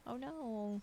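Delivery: usual speaking voice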